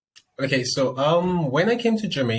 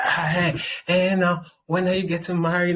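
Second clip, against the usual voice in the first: high, louder nagging mother voice; imitating her mom; 8 semitones higher, 3 dB louder than usual. Daughter mimicking her mom asking when she'll marry. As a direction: mimic a mom pushing for marriage. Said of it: "anytime I get a call from my mom".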